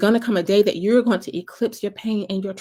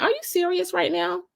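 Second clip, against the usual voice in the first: incredulous; 11 semitones higher than usual. Retold or imitated. Upset at friend's words.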